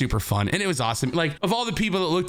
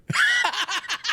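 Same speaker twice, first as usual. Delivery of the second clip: a sudden squeaky laugh of disbelief; high shocked laugh. Real, not acted. He learns the name was wrong and lets out a high shocked laugh. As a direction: burst into a high startled laugh.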